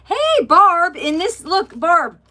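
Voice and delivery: loud, high, thrilled hail to Barb; excited call-out. Real, not acted. Excitedly calling out to viewer Barb.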